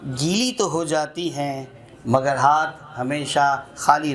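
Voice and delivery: measured, lilting recital with repeated lines; poem recitation. Acted. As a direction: savour each line like a poet at a mushaira. Recites a short nazm. (नज़्म पढ़ने का अंदाज़)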